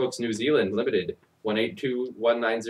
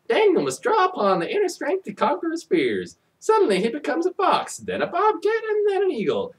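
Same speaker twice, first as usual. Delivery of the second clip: much higher, sing-song blurb voice; mock-epic blurb. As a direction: read the back cover like an overexcited announcer, up high.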